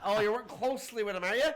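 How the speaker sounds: loud, high mock amazement; sarcastic challenge. Challenging an evasive official's claim, sarcastic. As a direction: throw the stock phrase back, pointedly.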